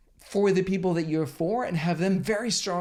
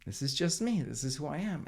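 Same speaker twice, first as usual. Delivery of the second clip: much softer and lower, gentle and certain; a softer take on standing for yourself; soft feminine way; 3 semitones lower, 6 dB quieter than usual. Shown as a demonstration. Showing the viewer the soft feminine way, quietly. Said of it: "done in a feminine way".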